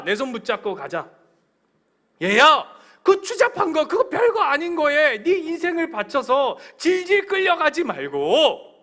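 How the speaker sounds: high, loud, slow tender plea; God pleading. Acted. God speaking to his people as a child. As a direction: call out like a pleading father.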